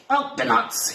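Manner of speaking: high bellow for help. A narrator's reading. Quasi calling the crew while tugging a tail, high bellow. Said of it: "he bellowed".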